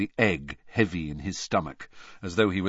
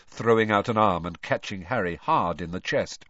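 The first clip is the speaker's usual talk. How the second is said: ordinary delivery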